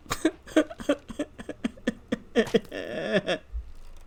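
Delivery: long, high run of chuckles; helpless giggling laugh; amused laugh. Real, not acted. Player cracking up after defending his companion, amused laughter.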